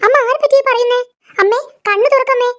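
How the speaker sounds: high, anxious pleading; worried calling. Acted. Daughter-in-law waking mother from a nightmare, anxious calls. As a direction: shake her awake, alarmed.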